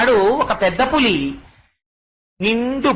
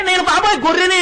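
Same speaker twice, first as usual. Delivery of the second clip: higher, crying plea; tearful sheep; 7 semitones higher, faster than usual. Acted. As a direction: sob out the words like a scared lamb. Cub insists it is a sheep.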